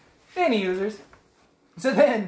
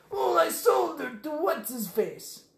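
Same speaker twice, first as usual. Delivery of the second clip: higher, slow shifty trader voice; sleazy merchant voice; 8 semitones higher, slower than usual. Retold or imitated. Host mimics the slaver to friend, sly and higher. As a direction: put on a greasy junk dealer drawl. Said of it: "he goes".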